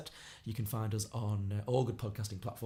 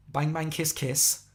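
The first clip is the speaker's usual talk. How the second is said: slow, tentative attempt at Scouse; Scouse accent; 4 semitones higher, 4 dB louder, much slower than usual. Shown as a demonstration. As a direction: have a go at a childhood Scouse accent. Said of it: "go back to my Scouse accent".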